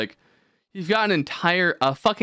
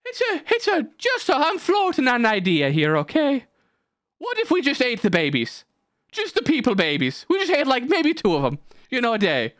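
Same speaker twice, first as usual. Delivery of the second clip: high, tentative mock-Irish voice; comic Irishman making a modest suggestion; Irish dude pitching an idea; 10 semitones higher than usual. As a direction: float it sheepishly in a raised voice. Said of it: "this Irish dude being like".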